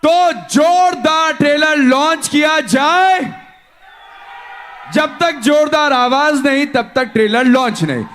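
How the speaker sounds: rally the crowd for the launch; announcer hype. Real, not acted. Host hyping the audience, announcer shout.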